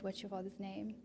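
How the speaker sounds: only half heard. Shown as a demonstration. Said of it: "He only heard".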